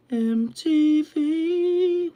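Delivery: put-on high, slow Welsh accent. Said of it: "that always makes me Welsh".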